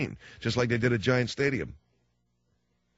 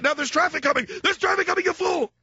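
high and loud, rising alarm; mock panicked yell; 4 dB louder than usual. Father yelling at son about oncoming traffic, loud and high. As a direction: shout the warning way up high.

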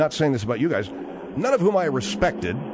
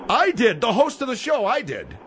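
indignant; 5 semitones higher than usual. Real, not acted. Host claims remark indignantly.

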